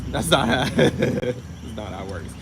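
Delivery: laughing through the words; amused, laughing rebuttal; breaking into laughter. Real, not acted. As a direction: crack up mid-sentence, then finish.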